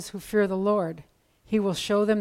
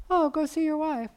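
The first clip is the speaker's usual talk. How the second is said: lightly voiced sly King David, higher; a hint of a sly king nudging him home; coaxing king; 8 semitones higher than usual. David coaxes the husband home.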